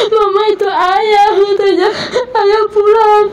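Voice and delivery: put-on high, slow childlike voice, near tears; tearfully voicing her son's excitement; voicing her small son. Mother retelling her son saying father is home, high and tearful.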